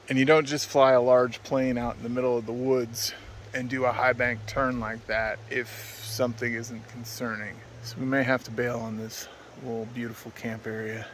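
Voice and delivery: ordinary talk, nothing marked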